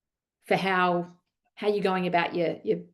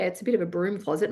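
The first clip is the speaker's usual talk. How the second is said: usual speaking voice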